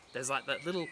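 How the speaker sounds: ordinary delivery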